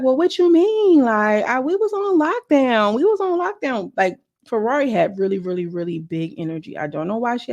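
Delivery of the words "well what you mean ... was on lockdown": voiced high and slow, like a friend hurt and defensive; high-pitched defensive friend. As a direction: pitch way up, draw out the excuse. Quoted argument, high and slow.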